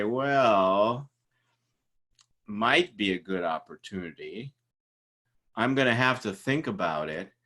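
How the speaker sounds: hesitant candidate, drawing out the words; mismatched slow reply. Acted. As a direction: answer slowly with long pauses. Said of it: "you're not pacing each other".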